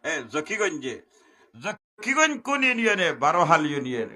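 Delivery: high, loud sermon cadence; loud preaching. Acted. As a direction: preach it out to the crowd. Preacher explains address at full force. (জোরালো ওয়াজের সুরে)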